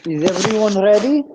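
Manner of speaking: put-on a playful first go at a Russian accent. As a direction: ham up a Russian host asking "Is everyone ready?". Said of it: "present it in a Russian accent".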